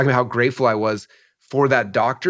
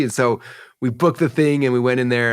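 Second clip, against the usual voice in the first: ordinary talk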